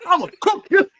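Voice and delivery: high, laughing "I'ma cook you"; high, laughing trash-talk taunt; cocky taunt. Retold or imitated. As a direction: taunt him with a grin, rising. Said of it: "I look around".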